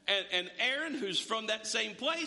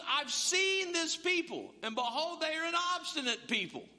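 exasperated God; 8 semitones higher than usual. Retold or imitated. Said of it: "he said to Moses".

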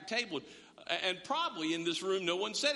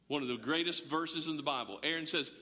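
usual speaking voice

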